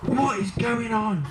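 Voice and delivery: a hint of a mother storming in; mock mum demanding. Mum bursting into the room, lightly voiced demanding.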